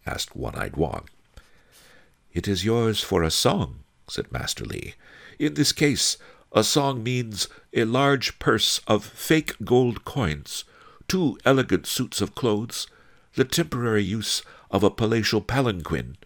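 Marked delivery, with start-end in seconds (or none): 2.3-3.8 high, polite bargaining voice; lightly voiced sage. A narrator's reading. Master Li naming his price to Wong, lightly courteous. 5.4-16.2 high character voice, evenly listing; bargaining sage. A narrator's reading. Master Li setting out his price to Wong, high-voiced.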